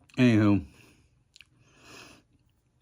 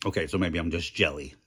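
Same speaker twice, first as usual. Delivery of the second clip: ordinary talk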